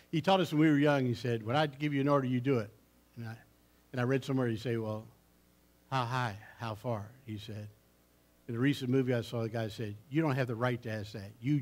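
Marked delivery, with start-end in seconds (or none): none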